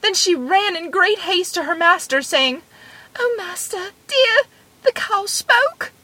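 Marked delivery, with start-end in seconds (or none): 3.2-5.9 high-pitched, alarmed maid's voice; frightened maid. A narrator's reading. Maid running in haste to her master, frightened. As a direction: report it to the master in a high, fearful voice.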